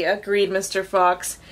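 usual speaking voice

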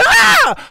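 very high shriek of a kicking man; imitated scream. Burglar kicking free, imitated high scream. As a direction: squeal like a trapped burglar. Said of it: "kicking and screaming".

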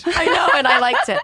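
laughing through it, pitch jumping up; laughing admission. Real, not acted. Confessing to her friends, laughing loudly. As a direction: blurt it out between laughs. Admitting she liked being grabbed.

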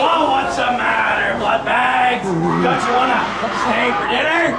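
slow, low, taunting vampire. Acted. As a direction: tease them like hungry prey.

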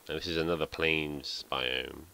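ordinary talk, nothing marked

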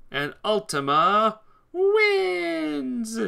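drawn-out high victory cry; slow, loud, gloating announcement; triumphant shout. Real, not acted. His own win, celebrating.

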